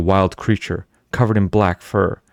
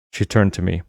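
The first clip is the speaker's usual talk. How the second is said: usual speaking voice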